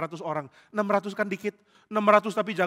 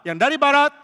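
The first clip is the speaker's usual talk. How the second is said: commander calling out; 9 semitones higher, 9 dB louder than usual. Acted.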